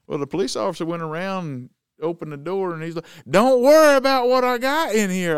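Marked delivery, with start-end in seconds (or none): 3.3-5.4 loud, much higher, mocking echo; mimicked trooper. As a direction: bellow it like a bossy cop.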